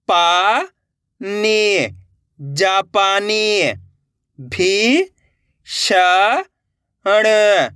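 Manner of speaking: slow, drawn-out sounding out with pauses; syllable-by-syllable reading. Shown as a demonstration. Teacher showing learners how to join letters, sounded out slowly. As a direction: stretch each letter, then join the word slowly. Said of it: "एक एक अक्षर का उच्चारण करके".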